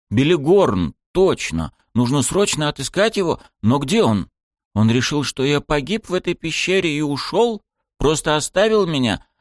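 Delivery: agitated. A narrator's reading. Sudden agitated realization.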